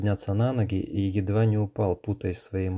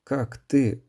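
stunned mumble; 3 semitones higher, much slower than usual. A narrator's reading. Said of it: "ошалело пробормотал парень".

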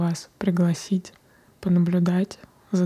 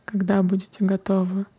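guided meditation. Acted. Closes the meditation.

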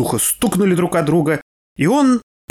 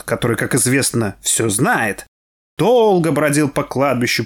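ordinary delivery

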